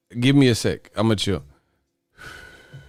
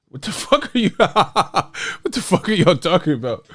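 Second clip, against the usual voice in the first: helpless laughter through the words; real laughing fit, barely getting it out; laughing hard; 6 semitones higher than usual. Real, not acted. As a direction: crack up while asking, pitched up. Cracking up at garbled messages.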